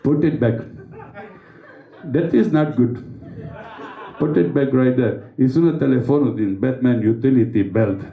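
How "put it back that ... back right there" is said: mock Indian accent, retroflex t in "it"; lightly put-on Indian accent scolding. Indian Batman scolding thieves, mock Indian accent. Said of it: "accent indian".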